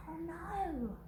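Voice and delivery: oh no. A narrator's reading. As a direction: sigh it out in pretend dismay. Reacting to dog's collapse.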